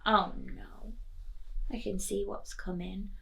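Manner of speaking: usual speaking voice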